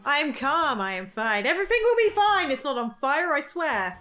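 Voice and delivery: hammed-up panic, rising loud and high; panicked self-reassurance. Lost wanderer reassuring themselves, mock-frantic. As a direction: insist all is well while losing it.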